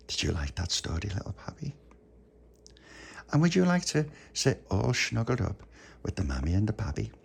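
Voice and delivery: quiet, gentle voice for the little one. Real, not acted. Talks to child softly.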